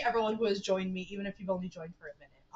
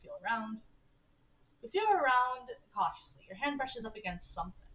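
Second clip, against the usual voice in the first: ordinary delivery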